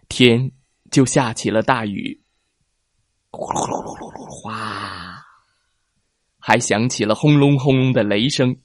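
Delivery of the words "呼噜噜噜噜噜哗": rain noise. A narrator's reading. Narrator imitates pouring rain.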